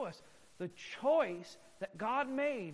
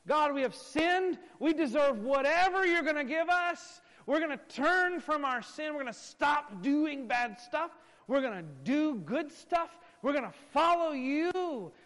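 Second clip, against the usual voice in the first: imitating eager repentance; 5 semitones higher, 5 dB louder than usual. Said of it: "does that sound like repentance?".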